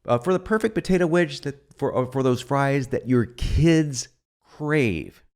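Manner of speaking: slow, savoured ad line, leaning on "crave"; slow infomercial read. Shown as a demonstration. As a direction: savour it like an ad hook. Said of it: "Check this out".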